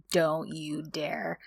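slow, soft and menacing, drawn out; low warning through the teeth; low quiet threat. Retold or imitated. Son to father, retold as a slow quiet threat.

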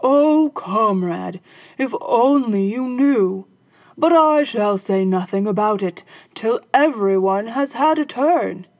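hammed-up sly glee; gaily. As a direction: tease the comrade with fake delight. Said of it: "he answered gaily".

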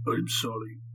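put-on captive with a heavy Russian accent; thick Russian accent. A narrator's reading. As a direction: speak English like a Russian prisoner. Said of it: "his thick Russian accent coming through".

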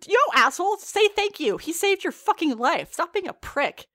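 high, loud telling-off; indignant scolding. Acted. Scolds the angry kid in imagination.

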